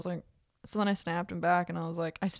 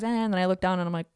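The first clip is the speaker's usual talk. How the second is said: ordinary delivery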